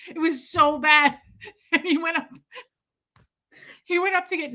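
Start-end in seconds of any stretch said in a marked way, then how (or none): none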